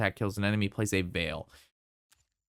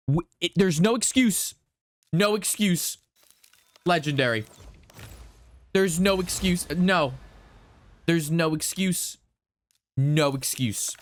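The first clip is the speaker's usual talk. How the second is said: calm turning angry; 5 semitones higher than usual. Real, not acted. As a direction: keep hammering on no excuse. Ranting about the bad pulls.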